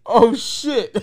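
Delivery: loud, high amused oh shit; laughing. Real, not acted. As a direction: laugh out the exclamation. Amused exclamation.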